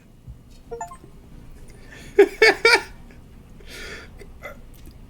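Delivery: high breathy laugh bursting out; burst of laughter. Real, not acted. Streamer cracking up at the game's dialogue, laughing. Streamer reacts to game line.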